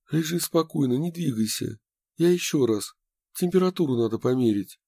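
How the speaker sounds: high, cracking with worry; voice breaking. A narrator's reading. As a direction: voice breaking from nerves. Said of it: "Его голос всё ещё срывался от волнения".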